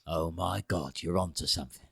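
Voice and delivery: lightly voiced stunned taster; mock awed gourmet. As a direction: savour it like a revelation. Impersonating tasting soup.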